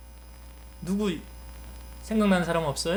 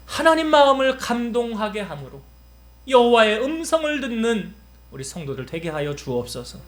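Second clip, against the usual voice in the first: rising, louder pastoral prayer; fervent prayer; 2 semitones higher, 5 dB louder than usual. Acted. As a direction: pray with mounting intensity over the congregation. Closing prayer.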